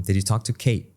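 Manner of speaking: "Kate" ending on a glottal stop, T unreleased; don't release the T in "Kate". Shown as a demonstration. Teacher demos a swallowed final T. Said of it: "no soltamos T".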